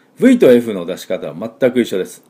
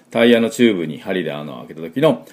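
normal talking voice

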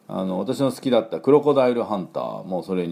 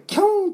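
imitated dog yelp; 4 dB louder, much faster than usual. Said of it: "とか言ってましたね". (犬の甲高い悲鳴の真似)

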